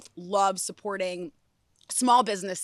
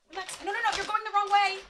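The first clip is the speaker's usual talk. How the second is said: high coaxing; 11 semitones higher, faster than usual. Real, not acted. Coaxing pet out of bag.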